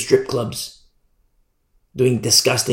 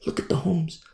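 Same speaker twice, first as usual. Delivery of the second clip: normal talking voice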